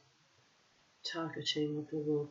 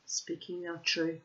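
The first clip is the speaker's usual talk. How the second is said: ordinary delivery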